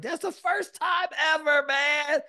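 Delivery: high, loud boast to a buddy; proud excitement. Retold or imitated. Proudly telling buddy he finished.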